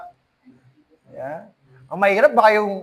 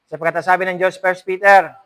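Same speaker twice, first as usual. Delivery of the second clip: usual speaking voice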